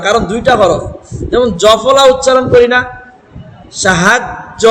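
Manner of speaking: ordinary talk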